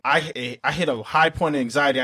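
ordinary talk

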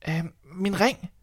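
put-on slow, high hesitant girl; hesitate, then offer the ring high; slow high girl's voice.

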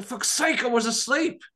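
put-on high, annoyed little kid; squeaky toddler grumbling at being woken; grumpy kid voice. Kid's grumpy reply voiced.

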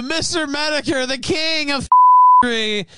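high, hammed-up indignant yell; mock outrage. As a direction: fake fury at the laughing chatter.

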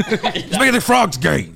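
high, loud, slowed bad impression; Alex Jones. Co-host failing at the impression, hammed-up ranting host. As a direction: draw it out in a strained high voice. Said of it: "No, I can't do it".

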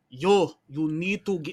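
put-on a light, casual New York accent; easygoing New York street accent, not overdone. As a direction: lean into a laid-back New Yorker voice. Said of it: "my New Yorker voice when I'm not trying".

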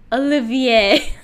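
Olivier drawn out slowly as a learner's French; nervous French attempt. Shown as a demonstration. Host trying the guest's name, Olivier in slow, careful French. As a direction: try Olivier in French, stretching it to end on -yay. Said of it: "I'm gonna sound really bad".

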